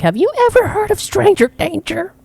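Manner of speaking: very high-pitched Karen mother; hammed-up shrieky mom question; imitated lecturing mom. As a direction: scold as an alarmed mom. Retold mom lecturing her child.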